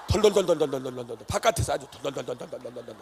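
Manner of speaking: loud chattering brrr with teeth; shivering imitation. Preacher showing how cold the crowd was, mock shivering. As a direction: chatter it out like freezing outdoors.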